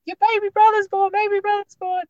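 high-pitched excited announcement, imitating a husband; excited calling out. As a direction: call the news out to the kids, high and thrilled. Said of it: "my husband was screaming out to the older boys".

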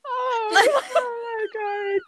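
long, high moaning mock wail; imitated drunken dismay. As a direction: draw it out high, like her whimpering. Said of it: "And you went".

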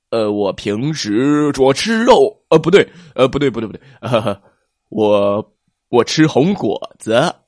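loud stammering correction; flustered fib. Acted. T-rex lying to plesiosaur about eating meat, flustered. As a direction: blurt the truth, then scramble to cover.

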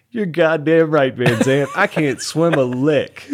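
laughing it out, high, loud and slow. Retold or imitated. Sergeant cracking up at the private's joke, loud laughing. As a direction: roar the line through big laughter. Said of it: "he busts out laughing".

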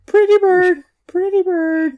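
high squawky talking bird; parrot voice. Pretend. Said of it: "Could you say pretty bird?".

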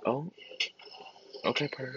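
ordinary talk

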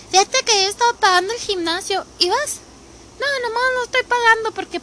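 high, mock-whiny voice of a gym dodger; imitating an acquaintance. Friend excusing herself for skipping the gym, mocked high and fast. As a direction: parody a friend who pays but never goes. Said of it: "me han dicho".

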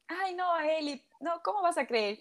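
dismissive. Retold or imitated. Husband's disbelief, retold.

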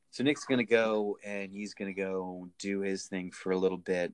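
low, hushed public-radio parody; soft NPR host. Pretend. Filling in until Nick gets back, soft radio-host parody. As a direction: keep it low and even, a mock radio host. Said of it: "really, really softly".

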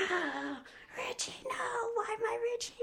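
high, soft, breathy mock lament; mother mourning her son, mock wailing; mock grieving wail.

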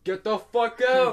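loud, high, drawn-out yell; hammed-up angry order; angry dismissal. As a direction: throw them out with a mock shout. Acted reply from a classroom.

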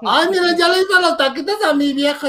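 high, loud mock housewife voice; simpering wife, hammed up; mock doting wife. Mocking a doting wife bragging about tacos.

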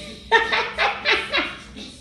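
high, breathless laugh; helpless high giggling; real laughter. Real, not acted. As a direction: crack up at your own impression. Laughs at own accent imitation.

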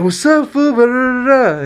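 high, loud mock homie greeting; hyped homie voice. Friend mimics a cocky homie pulling up, high loud call. Voicing homie who pulls up.

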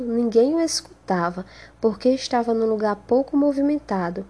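usual speaking voice

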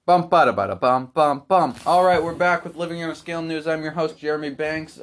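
loud, brisk anchor voice; news anchor. Acted. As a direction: open the newscast like an anchor. Jingle and loud news intro.

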